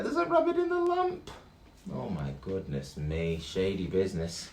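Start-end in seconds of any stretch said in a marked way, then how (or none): 0.0-1.3 very high squeak of alarm; squeaky surprise. Acted. Skeleton finds a rabbit in the lamp, squeaking high.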